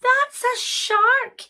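high, slow protest; exasperated Sarah. Acted. Sarah telling the magician it's a shark, exasperated. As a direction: draw it out in a high voice.

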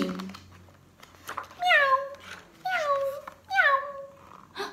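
high, soft little meows; squeaky kitty mewing; high kitten meow. Pretend. Cat sound in picture book.

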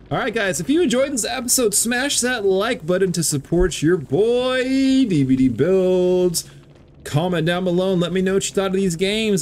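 upbeat high outro pitch; YouTuber outro. Acted. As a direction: sell the like button with hype.

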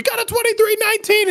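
squeaky voice. Retold or imitated.

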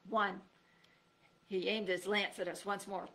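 ordinary delivery, nothing marked

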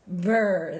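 loud shivering brr; cold shiver. A narrator's reading. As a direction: shudder it out like icy snow down your back. Snow down their backs.

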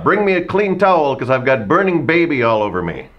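high, loud mock holler; calling out for help. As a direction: shout the translated call to your helpers. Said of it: "call out with my best radio voice".

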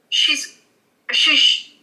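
amused surprise. Real, not acted. Surprised vision of apples.

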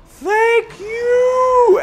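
very high, loud squeal of gratitude; acted relief. Driver reliving cops pulling over the other guy, squealed relief. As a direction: shriek it as if just let off. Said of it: "and you're like".

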